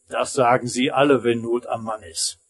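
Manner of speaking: ordinary delivery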